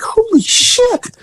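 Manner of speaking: high, slow stunned gasp of words; floored by it; very high drawn-out shocked aside. Real, not acted. Shock at informant's act.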